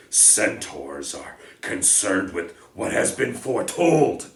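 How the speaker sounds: loud, contemptuous, scoffing centaur; angry outburst. Acted. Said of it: "Bane kicked up his back legs in anger".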